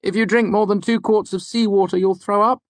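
high student voice, questioning; Fenton. Acted. Student trying another fact on the lecturer, asking.

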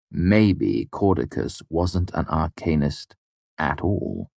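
ordinary delivery

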